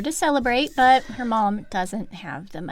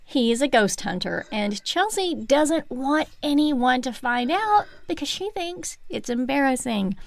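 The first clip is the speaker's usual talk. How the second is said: ordinary talk, nothing marked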